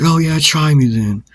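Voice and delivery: retold hostile challenge; taunting dare. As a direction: act out inviting a fight. Acted dare in fight.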